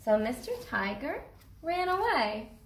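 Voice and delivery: ordinary delivery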